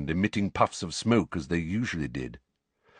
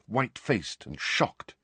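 usual speaking voice

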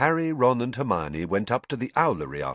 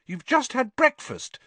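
high, louder, irritated scolding; irritable; 4 dB louder than usual. Acted. Hermione scolds Ron, mildly irritated. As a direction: snap it out, fed up. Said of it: "said Hermione irritably".

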